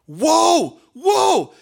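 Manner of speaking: high, loud astonished whoa; amazed whoa. Retold or imitated. The people hearing the voice from heaven, astonished. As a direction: gasp out in awe.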